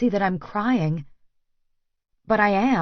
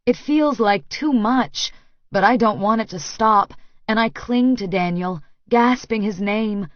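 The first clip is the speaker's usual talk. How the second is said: ordinary delivery, nothing marked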